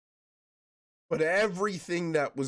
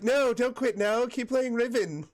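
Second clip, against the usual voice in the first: coaxing friend; 9 semitones higher than usual. Retold or imitated. Imitating a friend urging him to keep playing Riven, mock pleading. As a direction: egg him on, half laughing. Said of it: "She's always like".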